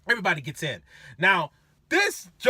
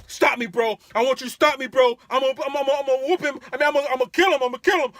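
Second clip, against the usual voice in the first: loud, raised ranting; angry drunk threats; 6 semitones higher, 7 dB louder, faster than usual. Retold or imitated. Drunk college kid begging driver to stop him, loud angry rant.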